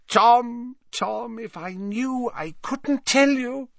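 put-on high, teasing old professor; jovial Slughorn voice. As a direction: wag a finger, playful scold.

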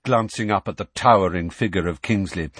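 ordinary delivery, nothing marked

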